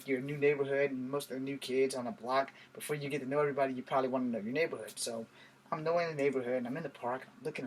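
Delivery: ordinary delivery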